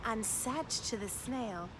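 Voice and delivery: put-on picture-book reading for children; lively storytime narration; story narration. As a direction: read it aloud with wonder for little ones. Reads picture book aloud.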